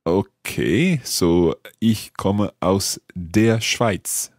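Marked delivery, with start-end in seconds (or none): 1.8-4.3 very slow, deliberate German sentence; drawn-out, careful language-lesson delivery; careful practice. Shown as a demonstration. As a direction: say it word by word, like a learner trying it out. Learner carefully tries the new sentence.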